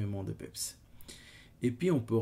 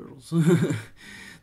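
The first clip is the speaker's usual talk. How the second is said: short, loud, high embarrassed laugh; 6 semitones higher, 11 dB louder than usual. Real, not acted. Laughing at his own English pronunciation, a self-conscious burst. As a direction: laugh at yourself after fumbling the word.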